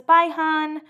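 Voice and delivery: high-pitched imitation of a farewell; retold girly goodbye.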